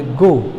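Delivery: "गो" as one single stroke; एक धक्के में. Shown as a demonstration. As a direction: say "गो" in one push of breath. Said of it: "हम एक बार बोले". ("गो" एक ही धक्के में)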